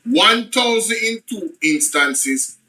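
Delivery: normal talking voice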